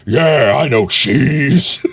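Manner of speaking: mock macho drawl; big man voice. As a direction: play the intimidating big man. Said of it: "and I go like".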